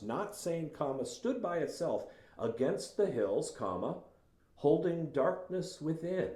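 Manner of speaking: high, resonant storyteller read; dramatic reading. Acted. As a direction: read it like the opening of a ghost story. Reads Hill House passage aloud.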